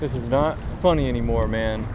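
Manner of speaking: ordinary delivery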